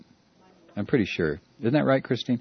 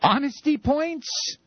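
incredulous; 8 semitones higher, slower than usual. Real, not acted. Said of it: "He didn't just say honesty points".

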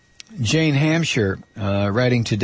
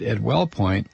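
ordinary talk

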